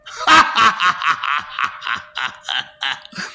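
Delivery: long staccato cackle, falling and slowing; mocking cackle. Gloating over crying babies, slow mocking cackle.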